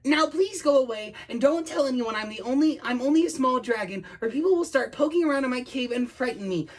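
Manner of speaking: high, pleading little dragon; high-voiced and mildly frightened; timid dragon. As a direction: plead like a scared small creature. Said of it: "The very idea of flying made him tremble".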